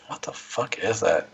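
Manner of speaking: ordinary delivery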